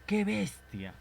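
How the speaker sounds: high exclaimed Qué bestia. Shown as a demonstration.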